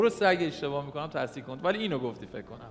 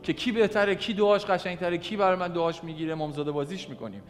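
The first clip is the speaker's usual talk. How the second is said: loud, high, fervent preaching; preaching at full force; 5 semitones higher, 5 dB louder than usual. Acted. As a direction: drive the message home to the congregation.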